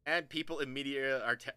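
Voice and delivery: ordinary talk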